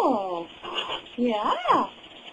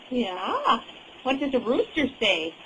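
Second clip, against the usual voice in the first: usual speaking voice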